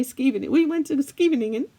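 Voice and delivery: put-on American accent. Mocking how American friends say Scheveningen. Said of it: "my American friends always say".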